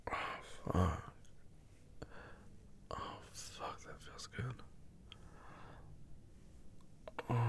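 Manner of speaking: very soft, airy whisper of pleasure; breathless whisper. Acted. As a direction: let the words out on long exhales.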